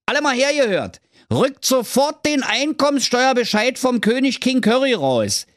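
rally the office like a herald; calling out. Acted. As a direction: Thorsten bellowing orders to the clerks. Thorsten calls out to clerks.

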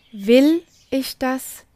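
Will pressed hard, slow and deliberate; stress on WILL. Shown as a demonstration. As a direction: lean into the word Will, slowly. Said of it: "alle drei Betonungen mit Ja beantworten".

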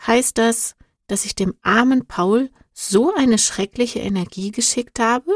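usual speaking voice

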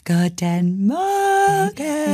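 slow, sing-song "Guten Morgen"; annoying drawn-out greeting. Retold or imitated. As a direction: stretch the greeting out like an annoying person. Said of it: "weil die immer schon so".

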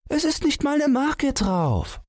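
put-on very high child's voice; little son protesting. A narrator's reading. Son argues over the envelope, high child's voice.

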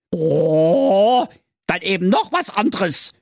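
high fairy voice, calling out; exasperated fairy. Acted. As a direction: groan the oh, then give in crossly. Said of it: "rief die Fee". (genervte Fee, hoch)

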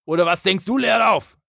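sehr hoch gebrüllte Frage; Gary hoch anbrüllen; angeschrien. Retold or imitated. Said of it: "Chavez schreibt Gary an".

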